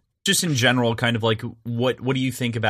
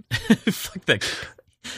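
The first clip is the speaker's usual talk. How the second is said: laughing; 13 semitones higher, much slower than usual. Real, not acted. Echoing the line laughing.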